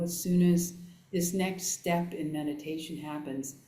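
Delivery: ordinary talk, nothing marked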